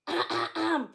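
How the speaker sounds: normal talking voice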